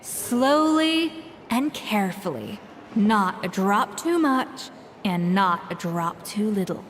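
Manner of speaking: slow, deliberate demonstration; measured, instructive and slow; slow and careful. Shown as a demonstration. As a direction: draw each word out as you pour. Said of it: "watch closely".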